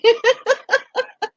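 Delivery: high laugh. Real, not acted. Laughs at Dee's comment.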